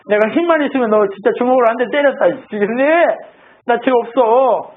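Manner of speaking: hammed-up aggrieved protest with broken starts; exasperated, wronged. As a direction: burst out as if dying of frustration. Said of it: "답답해 죽는 거죠".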